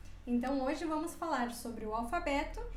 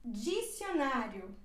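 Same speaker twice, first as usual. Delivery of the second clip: slow model word; 4 semitones lower, slower than usual. Shown as a demonstration. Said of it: "D com I".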